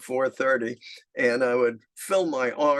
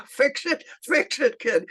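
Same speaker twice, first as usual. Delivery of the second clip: very high, breaking with laughter; laughing quote; 11 semitones higher than usual. Retold or imitated. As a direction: imitate a man laughing too hard to speak. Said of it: "he'd go into spasms of laughter".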